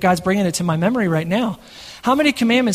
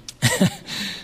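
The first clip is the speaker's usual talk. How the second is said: a sudden high giggle; quick embarrassed high laugh; 4 dB quieter than usual. Real, not acted. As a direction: laugh it off, pitched way up. Laughs after microphone mishap.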